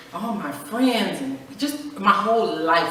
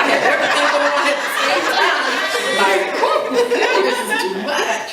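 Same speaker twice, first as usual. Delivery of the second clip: laughing; 9 semitones higher, 7 dB louder, faster than usual. Real, not acted. Overwhelmed, laughing.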